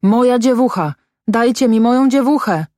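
high-pitched, pleading cry; frantic cry. A narrator's reading. Crazed mother demands her dead girl, frantic high cry. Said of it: "krzyczała szalona".

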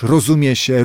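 ordinary delivery

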